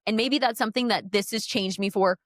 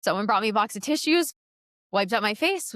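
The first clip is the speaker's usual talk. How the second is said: usual speaking voice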